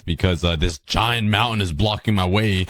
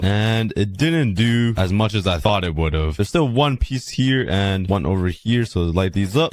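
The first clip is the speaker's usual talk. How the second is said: ordinary delivery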